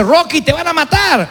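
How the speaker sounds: a hint of alarm for the fighter; worried onlooker. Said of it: "le dice".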